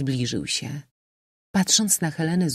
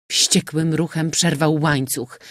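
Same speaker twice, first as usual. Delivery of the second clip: ordinary delivery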